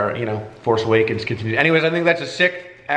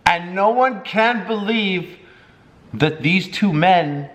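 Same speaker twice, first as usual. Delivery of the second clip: higher-pitched, storybook wonder; drawn-out, raised-pitch retelling; dramatic lyric recital; 6 semitones higher than usual. Acted. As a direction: recite it slowly like an amazing tale. Reciting the lyric as cute tale.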